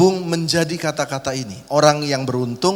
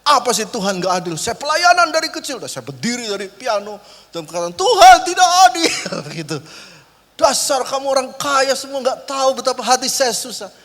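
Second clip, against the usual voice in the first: hammed-up anger at God; mock angry outburst; 9 semitones higher, 6 dB louder than usual. Preacher pretends to rage at God and rich guests, higher and louder. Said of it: "kalau saya marah sama Tuhan".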